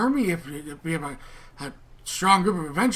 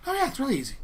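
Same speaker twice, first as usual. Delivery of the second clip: breezy; 8 semitones higher, much faster than usual. Acted. NPC breezy answer.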